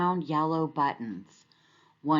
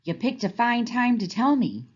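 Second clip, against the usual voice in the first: growling; 6 semitones higher, 4 dB louder than usual. A narrator's reading. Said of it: "growled Alligator".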